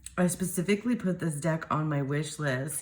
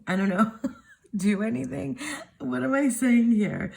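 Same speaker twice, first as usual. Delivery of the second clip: high-pitched, giggling through the words; flustered laughing; 9 semitones higher, 4 dB louder than usual. Real, not acted. Speaker lost in her own sentence, flustered laughing.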